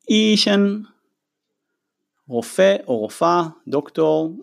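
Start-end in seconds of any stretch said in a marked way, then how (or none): 0.1-0.8 אישן pitched higher with careful tones; Chinese demo. Shown as a demonstration. Teacher modeling Chinese for the students. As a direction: say אישן up high, tone by tone. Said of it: "מילה הבאה".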